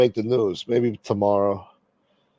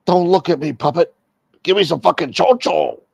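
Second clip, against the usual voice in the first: high, loud barked chat line read out; mock yelling; 6 semitones higher, 5 dB louder than usual. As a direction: yell it at the puppet like a bully. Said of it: "am I supposed to be yelling?".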